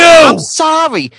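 slow, loud, high sorry; cowed guy shouting sorry; shouted apology. Acted. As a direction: yell the apology back. Acted guy apologizing under shouting.

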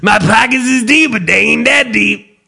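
hammed-up jazzy old-man catchphrase; jazzy grandpa. Grandpa repeating his quote to family, jazzy. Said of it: "Grandpa's talking jazzy again".

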